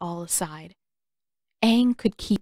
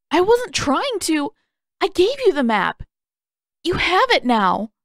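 put-on high, louder, desperate young voice; wobbly pleading boy; 10 semitones higher, 5 dB louder than usual. Aang begs Sokka and Katara, high and wobbling. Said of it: "Aang's voice wobbled".

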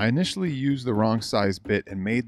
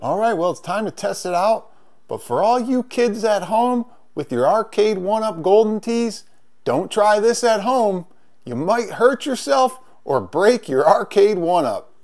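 much higher, louder, over-the-top TV host voice; hammed-up safety warning; 10 semitones higher, 6 dB louder than usual. Warning the kids at home not to try this, hammed-up high host voice. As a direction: ham up a mock warning like a cheesy TV host.

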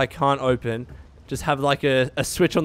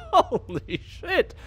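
high, drawn-out laughing exclamation; amazed and cracking up; 14 semitones higher, 3 dB quieter, much slower than usual. Real, not acted. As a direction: blurt it out through laughter. Laughing at salty game character.

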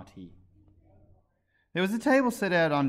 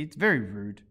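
severe; 2 semitones lower, 5 dB louder than usual. A narrator's reading. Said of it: "with some severity".

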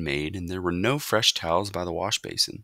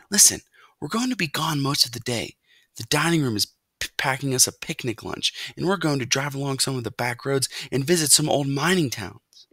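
put-on higher, eager boy voice; boy character, chatty; 8 semitones higher than usual. A narrator's reading. Boy tells mouse of the day's outing, eager. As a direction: share plans like an excited kid.

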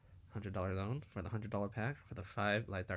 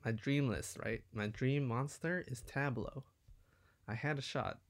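ordinary talk

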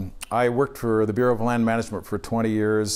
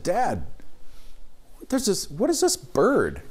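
put-on high, slow, wide-eyed kid's question; child asking dad; 10 semitones higher, slower than usual. Young son asking his dad about a bird, curious and high. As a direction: sound like a curious little boy at the window.

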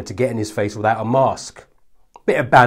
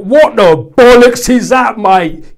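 loud, high jeering lout; hammed-up laddish jeer; mock lad; 11 semitones higher, 13 dB louder, slower than usual. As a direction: sneer it like a yob. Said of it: "and you're like".

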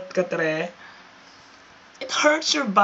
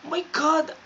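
exasperated; 7 semitones higher than usual. Acted. Exasperated at his claim.

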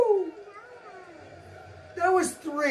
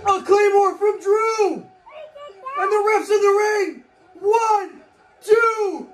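wrestling commentator; 6 semitones higher than usual. Real, not acted. Calling the near fall.

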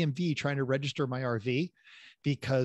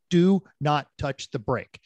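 slow, louder, each word weighted; emphatic instruction; 5 dB louder, slower than usual. Retold or imitated. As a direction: drive every word home slowly and firmly. Retelling his instruction to a driver.

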